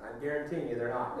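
ordinary delivery

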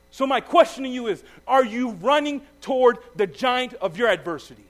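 fired-up sermon delivery; preaching. Acted. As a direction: push the challenge to the congregation. Preaching at full force.

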